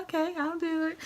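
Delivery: high, coy imitation of herself; playful agreeing. Wife agreeing to hubby's perfume offer, high and coy. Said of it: "So I'm like".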